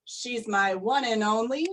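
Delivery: ordinary delivery, nothing marked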